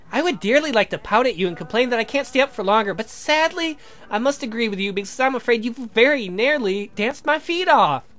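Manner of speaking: lightly voiced genteel young woman; a hint of a prim girl's voice; polite Helen. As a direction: play the well-mannered heroine.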